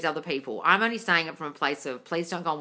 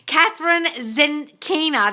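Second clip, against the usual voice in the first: high, loud and slow, dragging the name out; scornful slow-motion announcement of the name; incredulous name reading; 11 semitones higher, 8 dB louder, much slower than usual. Real, not acted. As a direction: say her name like you can't believe it. Reading out a dubious coach's name.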